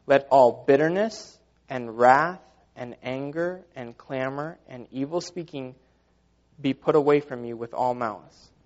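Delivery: slow, deliberate scripture reading; slow rereading. Real, not acted. Preacher rereading the verse to the congregation, slow and deliberate. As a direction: read it out phrase by phrase, letting each item land. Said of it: "Let me read that again".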